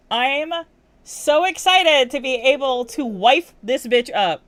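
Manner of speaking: loud, slow, high gush; gushing excitement. Real, not acted. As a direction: belt it out with glee. Gushing about Sandy.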